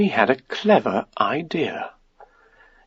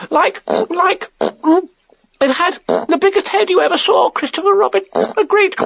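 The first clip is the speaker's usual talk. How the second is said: very high, squeaky, halting stammer; panicky Piglet. A narrator's reading. Piglet describing the heffalump to Christopher Robin, flustered. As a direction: squeak it out, groping for words between puffs.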